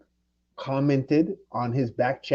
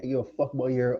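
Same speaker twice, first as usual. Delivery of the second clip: ordinary delivery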